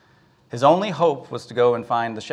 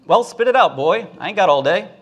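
loud, higher-pitched gruff impatience; impatient fisherman; 7 semitones higher, 6 dB louder than usual. Acted. Busy fisherman hurries a stammering boy along, impatient. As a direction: cut the boy off, punching it out.

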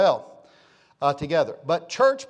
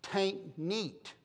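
soft East Texas twang; 3 semitones higher, 10 dB quieter than usual. Retold or imitated. Preacher imitating Miss Barbara, East Texas twang. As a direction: say it in a quiet East Texas drawl. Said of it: "say it this way in her neat, uh, East Texas, uh, twang".

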